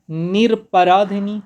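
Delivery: निरपराधिनी drawn out syllable by syllable; teacher slowing on निरपराधिनी; slow spelling-out. Shown as a demonstration. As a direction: say निरपराधिनी slowly so each part is clear. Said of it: "ये सही है".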